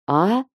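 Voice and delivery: short "А" with a questioning rise; "А" lifted as if asking to repeat; puzzled query. A narrator's reading. Scared trader asked by the king for a healer, puzzled rising "А". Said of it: "переспросил Водрок".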